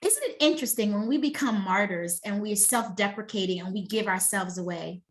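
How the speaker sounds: ordinary delivery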